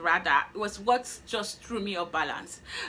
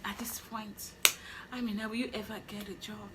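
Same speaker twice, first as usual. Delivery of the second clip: dejected murmur; 8 dB quieter than usual. Retold or imitated. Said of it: "I was like".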